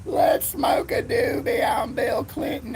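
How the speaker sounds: high, slow, shouted goofy voice; put-on voice. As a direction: ham up a silly stoned character. Opening joke voice.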